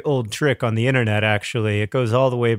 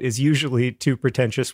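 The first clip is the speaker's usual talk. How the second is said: normal talking voice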